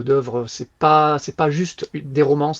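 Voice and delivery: slow, deliberate c'est pas juste des romances, each word weighed; emphatic slowdown; stress on pas. Real, not acted. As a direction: lean slowly on the word pas. Stressing the point slowly.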